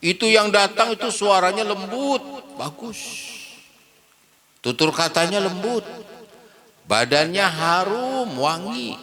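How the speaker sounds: high, lightly voiced old man; old man fondly remembering. Old man describing his gentle visitor, lightly voiced high. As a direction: lift the voice like a frail old man reminiscing.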